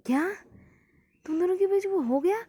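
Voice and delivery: high, loud disbelieving gasp of a question; shocked. A narrator's reading. Jimin hears V's confession, shocked. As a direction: blurt it out in shock. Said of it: "shocked कि ऐसा भी है".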